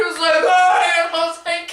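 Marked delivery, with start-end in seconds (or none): 0.4-1.0 high, loud retold hi; pitched-up mock greeting shout; excited greeting. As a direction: call it back brightly. Imitates friend calling back.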